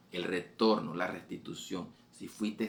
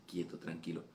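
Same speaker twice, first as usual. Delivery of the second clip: much softer, a hushed calming word; soft soothing voice; 3 semitones lower, 8 dB quieter than usual. Acted. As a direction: soothe a frightened listener gently. El Señor calma al oyente. (el Señor calma en voz muy baja)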